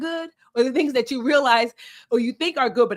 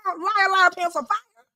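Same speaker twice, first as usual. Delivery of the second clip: sassy taunt; 8 semitones higher than usual. Acted. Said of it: "Your journal is like".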